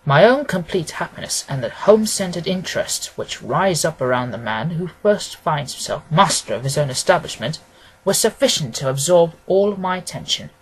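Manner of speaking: ordinary delivery, nothing marked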